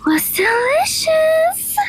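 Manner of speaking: high, drawn-out delighted cry. Acted. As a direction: savour the word, high and slow with delight. Finishes the meal happily.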